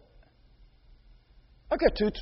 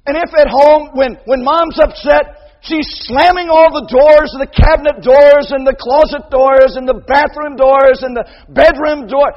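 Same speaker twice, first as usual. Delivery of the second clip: loud, higher building list; loud preaching; 9 semitones higher, 14 dB louder than usual. Real, not acted. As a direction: hammer each door home.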